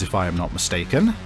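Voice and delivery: ordinary delivery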